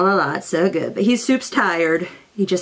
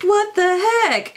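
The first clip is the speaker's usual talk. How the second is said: shocked squeak, much higher; alarmed high cry; 13 semitones higher than usual. Retold or imitated. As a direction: cry out in alarm at the sick dog. Alarmed at sick dog.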